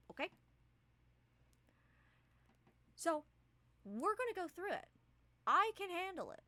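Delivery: high, pushy retort; stubborn guide brushing off the danger; cocky Spire. Acted. GM voices Spire arguing back.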